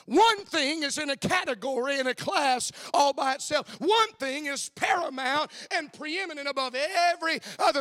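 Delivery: rising sermon fervor, pushing each line harder; preaching at full force. Acted. Preacher driving the Mary and Martha lesson home, building to shouts.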